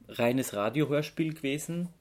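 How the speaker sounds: ordinary talk, nothing marked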